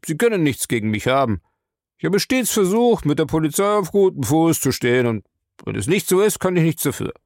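high-pitched, protesting character voice; aggrieved suspect. Acted. Bar owner protesting his honesty to the captain, aggrieved.

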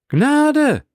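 high, loud joyful cry of the crowd; crowd shout. Acted. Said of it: "rief das Volk mit einem Schrei". (laut und hoch gerufen, wie eine jubelnde Menge)

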